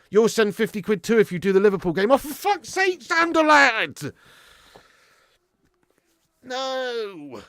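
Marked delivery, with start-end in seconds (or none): 2.1-4.0 very high, strained wail of protest; exasperated cry. Real, not acted. Streamer to viewer offering money, high exasperated outcry. Another offer to do Liverpool game. 6.4-7.4 high, soft, drawn-out moan; pleading refusal. Real, not acted. Streamer refusing viewer's offer, high drawn-out whine.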